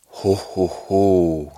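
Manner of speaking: loud, full ho ho ho from the belly; the real Santa laugh at last; deep Santa laugh. Acted. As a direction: fill the belly and boom it out like Santa. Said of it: "Lidt mørkere stemme".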